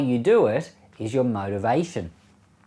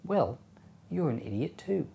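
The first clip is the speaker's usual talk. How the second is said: soft, mock-calm retort; 4 semitones higher, 8 dB quieter than usual. Answering someone who yells names at you, very calmly. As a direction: say it quietly and evenly, as if it were gentle. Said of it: "say back to them very calmly".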